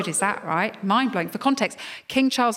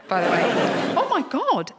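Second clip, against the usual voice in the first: usual speaking voice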